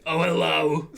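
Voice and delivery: Francine's greeting. Acted. Francine greeting someone at the table, loud and theatrical. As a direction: greet them loudly, in character.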